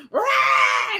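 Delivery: a sudden high shouted "Rah!"; combative roar. Retold or imitated. Host imitating her old combative self, shouted roar. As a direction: blast it out like a battle cry. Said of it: "And I'd be like".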